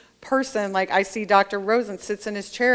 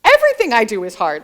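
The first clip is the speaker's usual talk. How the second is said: mock exasperation; 7 dB louder, faster than usual. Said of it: "I was like".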